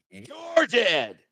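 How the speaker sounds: high, shouted you're dead; shouted threat. Acted. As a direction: bark it down the range. Acted shooter threat.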